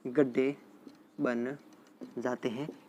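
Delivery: word-by-word for students taking notes; dictation pace. Real, not acted. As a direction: dictate गड्ढे बन जाते हैं in separate chunks. Teacher repeats phrase slowly for notes.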